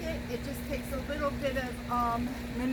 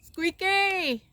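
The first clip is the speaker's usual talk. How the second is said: high, louder call with falling end; calling the cat; 8 semitones higher, 6 dB louder than usual. Real, not acted. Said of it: "Sorry, guys, for yelling".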